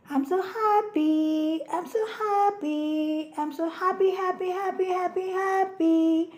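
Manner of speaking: happy sun voice. Acted.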